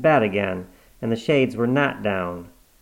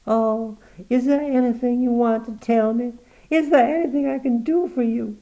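soothing daughter; 13 semitones higher, 4 dB louder than usual. Acted. Cornelia soothing her mother at the bedside, tender. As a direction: hush her gently.

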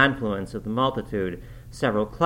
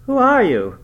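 loud, higher startled cry; frightened demand; 12 semitones higher, 10 dB louder than usual. Acted. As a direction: challenge the thing at the window in fear. Terrified sleeper calls at ghost.

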